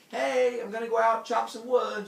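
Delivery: loud, high, cartoonish husband; hearty announcement. Heracles telling his wife he's heading out, loud cheery call. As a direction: call it out big and eager.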